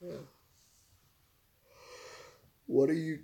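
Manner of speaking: ordinary talk, nothing marked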